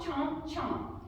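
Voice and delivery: normal talking voice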